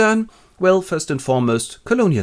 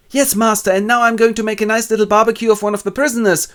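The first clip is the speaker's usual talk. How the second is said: eager servant; 11 semitones higher, 5 dB louder than usual. Acted. Friday's cheerful voice.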